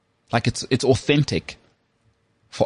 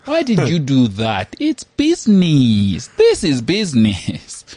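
slow, drawn-out mock complaint; put-on character voice; 3 semitones higher, slower than usual. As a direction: stretch it out in a hammed-up character voice. Playful impression after being asked.

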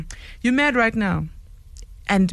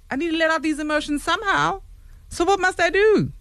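high-pitched, exasperated pleading; mock-exasperated whine of protest; worked-up protest; 7 semitones higher than usual. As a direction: argue back with pitch climbing. Acting out an angry argument.

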